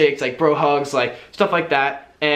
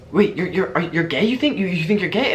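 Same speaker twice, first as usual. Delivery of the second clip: mock eager, a bit higher, stumbling over the words; imitating excited friend; 4 semitones higher than usual. Drunk friend thrilled by the coming-out hint, lightly imitated excitement. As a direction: play the drunk friend suddenly thrilled by the news. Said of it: "they got super excited".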